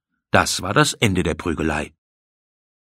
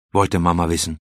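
ordinary delivery

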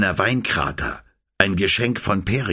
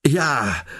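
unwilling; 7 semitones higher than usual. A narrator's reading. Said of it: "räumte Philon nur unwillig ein".

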